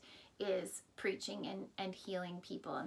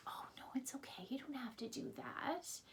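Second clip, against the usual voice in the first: hushed, breathy plea to a friend; whisper; 4 semitones higher, 8 dB quieter than usual. Retold or imitated. Peter pleading with Jesus not to go, whispered. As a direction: coax him softly, almost a secret.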